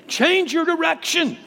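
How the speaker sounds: climactic preaching cry. Acted. Preacher pleading at the peak of the sermon, high and ringing. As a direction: lift it into a high urgent plea.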